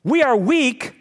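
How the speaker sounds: ordinary delivery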